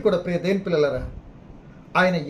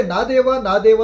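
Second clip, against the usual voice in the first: a loud, anguished call to God, louder on the second దేవా; loud cry; 6 dB louder than usual. Retold or imitated. Jesus on the cross calling out to God, a loud anguished cry. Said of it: "అని పలికింది ఒకసారి యేసుప్రభుల వారు".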